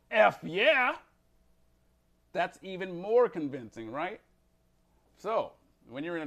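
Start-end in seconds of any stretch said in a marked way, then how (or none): 0.1-1.0 shouted, pumped-up F yeah; loud F yeah. Shown as a demonstration. Teacher fired up, shouting the curse. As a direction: yell it like you mean it. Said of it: "and you go".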